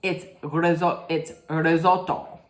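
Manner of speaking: "risotto" with short Italian o, ri-ZOT-to; careful Italian "risotto", crisp double t. Shown as a demonstration. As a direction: say "risotto" as an Italian mother would. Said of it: "if I didn't say it like this".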